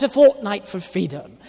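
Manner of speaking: "fortnight for freedom" in an English accent. Shown as a demonstration. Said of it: "I've spared you my English accent".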